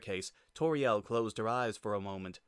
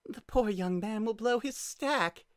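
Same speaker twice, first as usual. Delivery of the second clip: gleeful; 12 semitones higher than usual. Acted. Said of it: "wiped gleeful tears".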